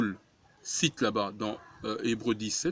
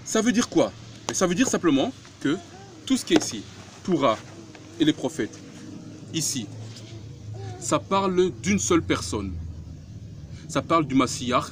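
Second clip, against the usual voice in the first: rising sermon fervour; 4 semitones higher than usual. Acted. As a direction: build to the point with fervour. Preaching climax.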